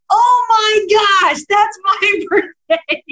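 high, loud delighted cry, laughing through; retold coworker shouting with delight; excited shout. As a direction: shout it in joyful shock.

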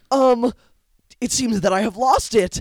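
high, hesitant dubbed voice; timid Gi-hun. Retold or imitated. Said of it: "he goes".

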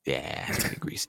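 breathy whisper of "greasy"; hushed aside. Real, not acted. Telling friends the tale was shady, whispered softly. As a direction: drop to a confiding whisper.

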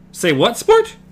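high, loud puzzled question; surprised query. A narrator's reading. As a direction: toss the question up sharply. Eddie quizzing Jake.

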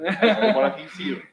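laughing. Real, not acted. Joke about the move upstairs.

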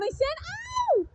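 drawn-out falsetto wail, imitating a hurt woman; lady's voice. Lady at the back of the train pleading with her attacker, slow high mock wail. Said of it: "She's going".

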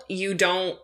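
ordinary talk, nothing marked

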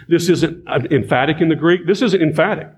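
ordinary talk, nothing marked

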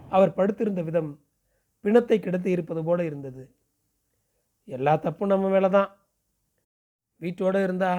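plain reading voice

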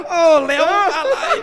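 loud, high call to a friend. Real, not acted. Guest calling out to a friend, loud and high. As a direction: call across the studio.

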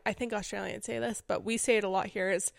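usual speaking voice

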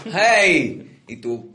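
loud sudden hey; mock German call. Acted German calling out. (grito fingido de alemán)

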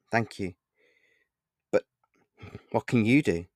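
understated solemn reply, halting. A narrator's reading. Narrator replies to sympathy about mum, solemn. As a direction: answer solemnly, pausing between phrases. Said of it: "I replied solemnly".

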